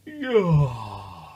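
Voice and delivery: loud, drawn-out sleepy yawn; big yawn. A narrator's reading. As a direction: stretch into a long waking yawn. Sleepy someone waking.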